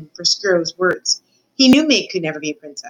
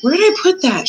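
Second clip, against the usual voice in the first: lightly acted sleep-talk, pitched up; sleep-talking mumble; 8 semitones higher, 4 dB louder than usual. A narrator's reading. Cook fretting over a lost recipe in her sleep, mild sleep-talk. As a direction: fret mildly as if dreaming. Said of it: "she mumbled in her sleep".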